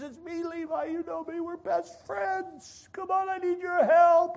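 high-pitched insistent plea; pleading. Acted. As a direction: beg a friend through the door, voice climbing. Acted plea at friend's door.

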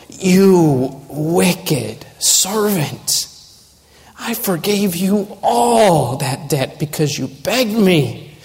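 disgusted master. Acted. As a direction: rebuke in disgust, drawn out at first, then rising in pitch. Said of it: "Do you see the disgust that he has".

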